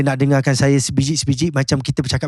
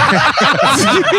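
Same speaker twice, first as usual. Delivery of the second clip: sudden high laugh; 12 semitones higher than usual. Real, not acted. Laughs at colleague's voice remark.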